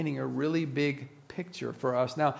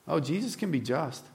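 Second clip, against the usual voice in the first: normal talking voice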